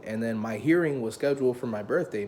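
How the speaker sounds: ordinary talk, nothing marked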